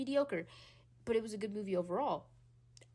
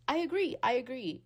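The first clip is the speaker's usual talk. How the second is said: emphatic; 5 semitones higher, 8 dB louder than usual. Real, not acted. Emphatic agreement, repeated.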